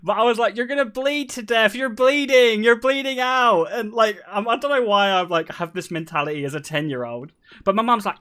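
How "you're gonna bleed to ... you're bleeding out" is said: put-on high, mounting kid-brother taunt; retold alarmist taunt. Brother scaring his bleeding sister, high mock-alarm taunt. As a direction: build from high teasing to a loud, drawn-out cry.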